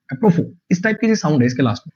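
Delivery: ordinary talk, nothing marked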